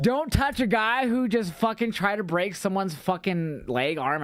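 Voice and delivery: high, slow indignant mock protest; mock outrage. Retelling his rant at the useless security, high indignant mock outrage. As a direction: raise it high, drag it out in disbelief. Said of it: "And I'm like".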